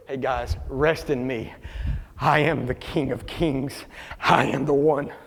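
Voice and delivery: low, soft and slow; calm, unhurried authority voicing Jesus; quiet, settled invitation; gentle reassurance. Jesus gently answers the Pharisees.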